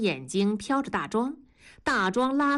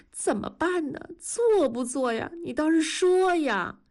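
high-pitched, anxious urging; fretful nagging; 8 semitones higher than usual. A narrator's reading. Sleepless wife pokes husband for a decision, fretful. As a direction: badger him for an answer, high and fretful.

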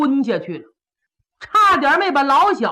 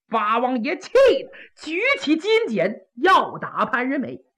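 usual speaking voice

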